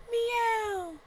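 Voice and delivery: high, small mock meow; a preacher mocking the devil as a house cat; tiny cat meow. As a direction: make the devil a harmless kitten.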